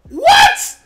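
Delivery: high, loud startled squeak; shocked yelp. Real, not acted. Gamer shot by an enemy, shrieked what. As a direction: squeal in disbelief.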